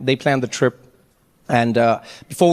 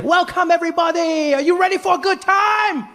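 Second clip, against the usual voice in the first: much higher, shouting guide welcome; overeager tour guide; 10 semitones higher than usual. Retold or imitated. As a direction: greet them like a way too happy guide. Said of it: "he's just like".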